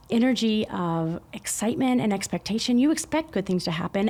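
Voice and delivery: ordinary talk, nothing marked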